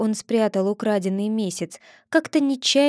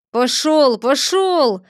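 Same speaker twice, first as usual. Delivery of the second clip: loud shout, higher; 5 semitones higher, 6 dB louder, slower than usual. A narrator's reading. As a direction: drive him off with a shout. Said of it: "кричал кузнец". (кузнец громко гонит Чуба)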